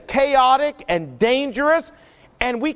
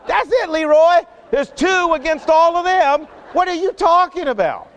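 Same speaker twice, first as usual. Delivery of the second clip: incredulous servant; 6 semitones higher than usual. Acted. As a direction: scoff at the boss like he's lost it. Servant doubts Elisha's claim.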